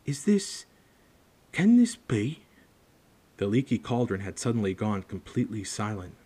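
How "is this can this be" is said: high, halting amazement; barely believing his eyes; disbelieving old man. Acted. Bartender in disbelief.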